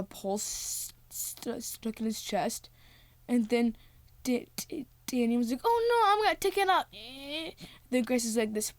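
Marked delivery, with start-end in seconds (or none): 5.6-6.9 high, fast squeaky voice; hammed-up frantic squeal; mock panic. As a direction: rush it out in pretend alarm. Voicing Danny in a panic.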